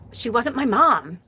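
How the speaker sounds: normal talking voice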